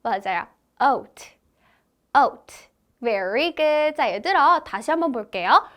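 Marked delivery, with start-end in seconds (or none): 0.8-2.7 oat said slowly, clearly; phonics demo. Shown as a demonstration. Teacher demonstrating oat for children to copy. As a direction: model oat for kids. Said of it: "T는 T 사운드니까".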